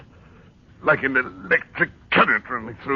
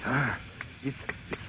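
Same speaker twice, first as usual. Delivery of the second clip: ordinary delivery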